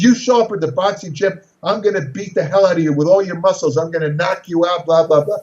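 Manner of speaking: normal talking voice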